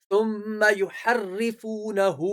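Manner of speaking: slow recital, final hu of يُحَرِّفُونَهُ drawn out; lengthened final vowel. Shown as a demonstration. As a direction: stretch the hu ending of يُحَرِّفُونَهُ. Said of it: "ہو کو کھینچنا ہے، لمبا کرنا ہے". (يُحَرِّفُونَهُ کے ہو کو کھینچ کر پڑھنا)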